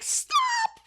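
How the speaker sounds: very high, drawn-out mock wail; hammed-up crying damsel, thin and high; tropey heroine. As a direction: squeal a melodramatic tearful plea. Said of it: "tropiest of tropes of just, like".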